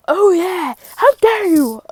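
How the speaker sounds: high, loud mock indignation; acted outburst. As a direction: cry out in playful outrage.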